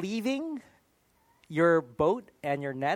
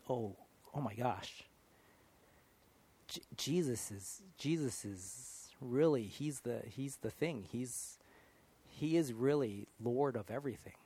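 hushed awe; 6 semitones lower, 8 dB quieter, slower than usual. Retold or imitated. Acted moment of realising Jesus.

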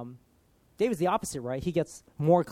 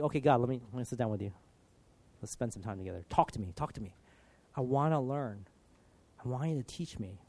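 softer, lower voice, as in private prayer; calm, tender plea; 4 semitones lower, 5 dB quieter, faster than usual. Acted. Believer sitting down with God, calm and tender. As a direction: speak gently, quietly asking to be taught.

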